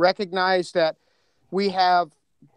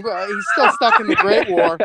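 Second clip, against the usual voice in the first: laughing; 10 semitones higher, 6 dB louder than usual. Real, not acted. Joking about Graham's accent.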